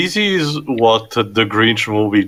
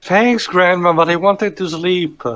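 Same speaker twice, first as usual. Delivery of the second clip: granddaughter voice; 9 semitones higher than usual. Acted. Acting granddaughter in story scene.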